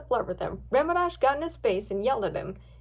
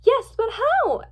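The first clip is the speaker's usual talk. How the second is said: high, loud, drawn-out question; slow, high exclaimed question; urgent question; 11 semitones higher, 7 dB louder, slower than usual. A narrator's reading. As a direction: ask anxiously how to manage a rescue. Friend urgent about the rescue.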